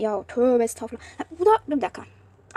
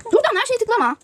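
higher and louder, panicked stop; sharp exclamation; 9 semitones higher, 5 dB louder than usual. Real, not acted. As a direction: cry out to stop clicking. Scolding herself, game froze. (yüksek sesle telaşlı uyarı)

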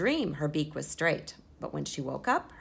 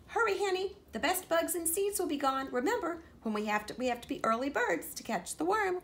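high, bright hen voice hurrying her; clucking hen sister; 9 semitones higher than usual. A narrator's reading. Sister hurrying Henrietta to breakfast, high clucking voice. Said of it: "clucked Sister".

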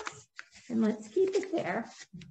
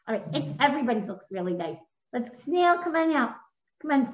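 usual speaking voice

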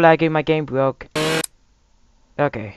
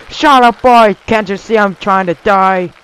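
loud mock yell; mock angry dad; 5 semitones higher, 7 dB louder than usual. A dad yells at his son, mock angry. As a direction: snap like a cranky dad.

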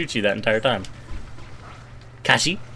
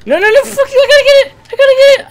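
high panicked yell; frantic shouting; 10 dB louder, faster than usual. Real, not acted. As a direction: scream it in a panic. Frantic mid-game shouting.

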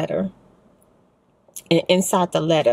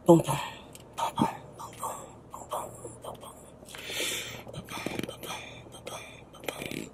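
softly whispered boom boom like a heartbeat, fading away; heartbeat sounds; 13 dB quieter, faster than usual. Shown as a demonstration. A vlogger imitates a heartbeat for her viewers, fading whispered booms. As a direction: mimic a heartbeat, softer and softer to a whisper. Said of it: "you all are my second heartbeat".

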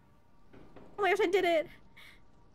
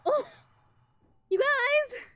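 startled yelp; 7 semitones higher than usual. Real, not acted. Startled shout at friends.

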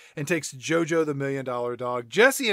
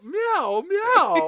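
high cat mewing, climbing higher; imitated meows; 13 semitones higher than usual. Cat joining the starlet routine, pitiful mock mews. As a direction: mew pitifully in mock distress.

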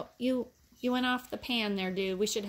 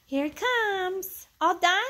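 much higher, louder cooing at the puppies; 10 semitones higher, 6 dB louder, slower than usual. Real, not acted. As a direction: coo hello to the puppies. Greeting puppies in baby talk.

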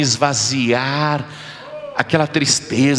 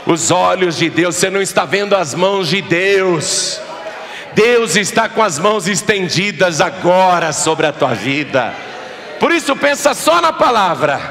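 raised, insistent preacher voice; fervent preaching; 3 semitones higher than usual. Acted. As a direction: preach it out over the congregation. Preaching at full force.

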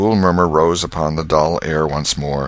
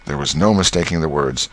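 ordinary delivery

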